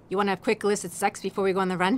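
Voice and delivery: fast, low, soft sarcastic quip; quick dry jab; sarcastic aside. Acted. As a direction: toss it off under your breath.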